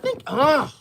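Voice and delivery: ordinary delivery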